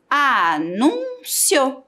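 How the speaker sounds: a-nún-cio bem devagar, sílaba por sílaba; separando as sílabas. Shown as a demonstration. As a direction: solte anúncio sílaba a sílaba. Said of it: "Três sílabas". (anúncio separado em sílabas, bem lento)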